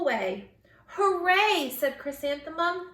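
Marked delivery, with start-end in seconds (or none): 0.9-1.7 high, loud happy cheer; excited cheer. Acted. Girl running to her first day of school, cheering loudly. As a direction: cheer it out, bursting with joy.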